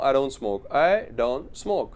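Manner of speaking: "don't" said as don, final t dropped, slowly; don without t. Shown as a demonstration. Teacher demonstrates the dropped t for learners. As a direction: say "I don't smoke" with don, no t sound. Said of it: "डोंट भी बोलने की जरूरत नहीं है".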